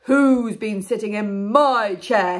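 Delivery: slow, booming big bear voice; very loud Father Bear. Acted. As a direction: boom it out slowly as father bear. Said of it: "in a very loud voice".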